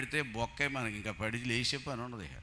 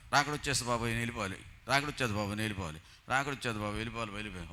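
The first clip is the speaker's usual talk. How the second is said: rapid mock quarrel; 2 semitones lower, much faster than usual. Two women quarrelling, retold.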